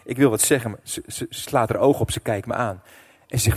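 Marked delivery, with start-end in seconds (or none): none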